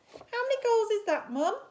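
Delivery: high, quick small-spider voice; eager little spider asking Mum. Acted. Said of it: "asked Spinderella".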